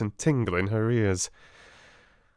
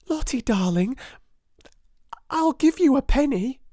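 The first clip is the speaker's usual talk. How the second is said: high, louder coaxing schoolgirl voice; coaxing girl; 6 dB louder than usual. A narrator's reading. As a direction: bribe the little one sweetly. Girl coaxing crying child.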